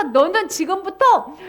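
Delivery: normal talking voice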